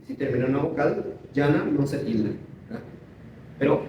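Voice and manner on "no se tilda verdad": ordinary talk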